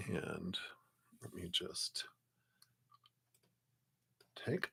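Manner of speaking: soft unvoiced whisper. Real, not acted. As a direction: breathe the words, no voice. Muttering to herself while checking chat.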